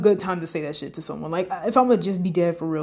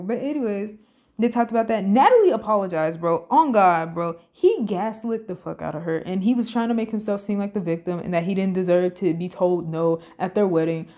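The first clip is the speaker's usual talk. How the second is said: ordinary talk, nothing marked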